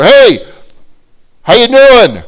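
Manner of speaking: very high, loud holler; screaming greeting. Retold or imitated. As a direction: yell hello down a long hall. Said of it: "He screams to her".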